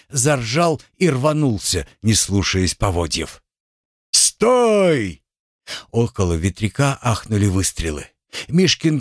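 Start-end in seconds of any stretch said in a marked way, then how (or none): 4.1-5.2 shout. Acted. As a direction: yell after the bolting rider. Sentry yelling as horse bolts.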